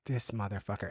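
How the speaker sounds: very soft, low aside; muttered cursing. Retold or imitated. Friend on the flight cursing the boyfriend, muttered low. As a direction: curse him quietly to yourself. Private curse retold from the flight.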